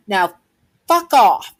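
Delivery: high, loud acted outburst; angry dismissal. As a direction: pause after the first word, then burst out. Child orders bullies away.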